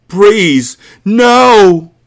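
very high, loud cry of grief; anguished yell. A narrator's reading. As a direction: scream it at her body. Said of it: "he yelled".

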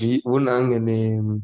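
slow drawn-out mock disgust. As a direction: stretch it in a put-on voice. Repeated slowly in odd voice.

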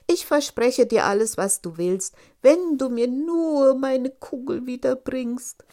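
loud, high princess promising anything; eager princess. Acted. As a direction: promise it all, loud and pleading. (eifrig versprechende Prinzessin, laut und hoch)

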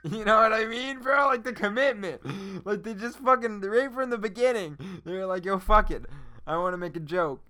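hyped-up shouting about the film; excited rant. Real, not acted. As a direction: rave about it at full volume. Raving about the movie's commitment.

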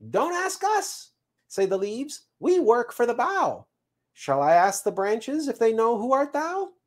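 high, sing-song squirrel voice; squirrel messenger reporting. Acted. Squirrel brings the seed the leaves' answer, chirpy.

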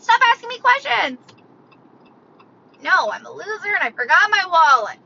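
high, hammed-up protest; exasperated outburst. Walletless shopper fed up with cashier's questions, high exasperated outburst. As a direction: burst out in mock exasperation, pitch way up.

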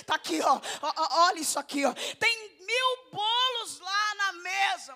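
loud, laughing call; excited laughing preaching. Real, not acted. Preacher laughs while acting out the widows showing the clothes, excited. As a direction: show it off with glee.

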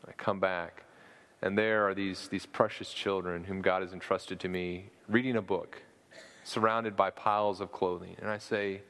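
ordinary talk, nothing marked

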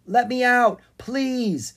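high, loud plea to be let out; crying out. A narrator's reading. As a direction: call out urgently from inside a parcel. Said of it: "he cried".

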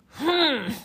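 loud, high cartoon grumpy "hmph"; hammed-up huff of annoyance; grumpy harrumph. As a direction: harrumph like a cross little panda. Grumpy panda harrumphs in story.

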